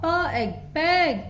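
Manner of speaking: प, एग, पेग sounded out slowly; slow teacher blending. Shown as a demonstration. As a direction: build पेग piece by piece. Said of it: "P का phonic sound प".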